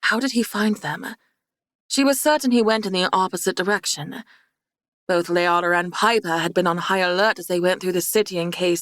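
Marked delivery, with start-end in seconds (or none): none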